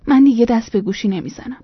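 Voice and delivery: plain reading voice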